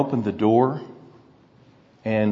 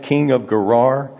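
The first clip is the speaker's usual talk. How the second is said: usual speaking voice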